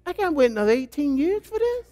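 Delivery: lightly acted exasperation; dismayed husband. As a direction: complain like a dad facing a long wait. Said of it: "And you're like".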